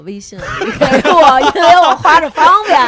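laughing. Retold or imitated. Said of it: "他说，".

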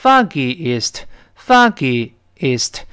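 "foggiest" slowly broken, foggy ... ist; syllables separated. Shown as a demonstration. Teacher breaks it up for students, "foggiest" slow as foggy, ist. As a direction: pause inside "foggiest" before the final ist. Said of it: "因为它有两个e".

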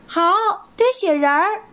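high bunny voice agreeing happily; happy little rabbit. Acted. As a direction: cheer up through tears.

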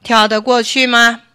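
loud mock-tiger challenge; fox imitating tiger. A narrator's reading. Fox asks the rabbit at the river, imitating a tiger. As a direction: ask it with a borrowed tiger's swagger. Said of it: "又学着老虎的口气问兔子".

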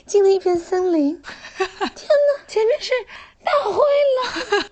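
high, breathless, half-laughing dramatic narration; hammed-up radio drama voice. Imitating an over-the-top voice actress for a friend, breathless and high. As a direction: overact a spooky audio drama, giggling through it. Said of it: "就是类似于这种".

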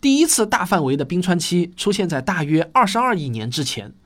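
ordinary delivery

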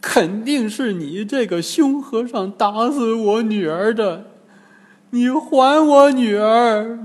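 high-pitched wailing accusation; scolding. Acted. Old woman grabs Bajie over her dead daughter, scolding. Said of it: "突然揪住八戒骂道".

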